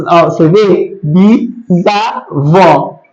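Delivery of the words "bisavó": "Bisavó" very slowly split into bi, za, vó; syllable by syllable. Shown as a demonstration. Teacher showing the class how the prefix splits, "Bisavó" said syllable by syllable. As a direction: break "Bisavó" into three drawn-out syllables, S joining the A. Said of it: "então eles ficam juntos".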